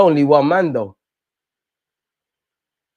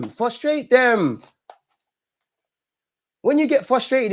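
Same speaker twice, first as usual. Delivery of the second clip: high exasperated pleading; 10 semitones higher than usual. Real, not acted. Fan lecturing team on screen.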